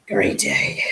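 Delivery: low breathy whispered tease; teasing whisper. Real, not acted. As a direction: whisper it as a cheeky jab. Teasing viewer who wants all.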